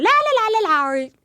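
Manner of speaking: high, playful yapping syllables; high silly babble. Shown as a demonstration. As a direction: chatter nonsense up high to annoy. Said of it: "All I do is just".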